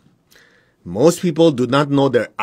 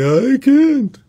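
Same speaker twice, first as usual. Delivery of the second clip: much higher, louder, slow mock whine; whiny helpless excuse; 13 semitones higher, 5 dB louder than usual. Teacher mocking the excuse he rejects, high whine. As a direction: wail the excuse like a helpless child. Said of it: "That's why they say".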